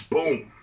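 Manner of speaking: loud, punched boom; imitated kick impact. Describing kicking the man off the train, loud impact noise. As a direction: voice the thud of a big kick.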